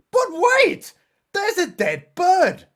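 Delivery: high and loud, slowly announcing a hammed-up discovery; mock alarm. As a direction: gasp it out like a horror trailer. Mock alarm at a dead bird.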